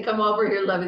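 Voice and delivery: loud, lightly voiced tavern woman; coaxing call to a stranger; mock Irish mother. Studio 54 crowd would never call out like this, loud. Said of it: "They wouldn't say".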